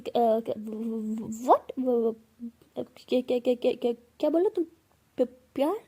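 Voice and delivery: loud stuttering disbelief; shocked stammering. Acted. Pihu hears Bhavna say love, loud shocked stammer. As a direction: stumble over the words in shock.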